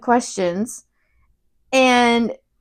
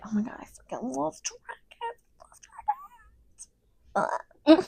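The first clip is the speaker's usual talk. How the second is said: hammed-up hushed fangirl rapture; whispered gushing; 7 semitones higher, 11 dB quieter than usual. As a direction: gush in a breath-held whisper. Retells Hagrid gushing over dragons.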